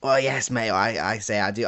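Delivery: ordinary talk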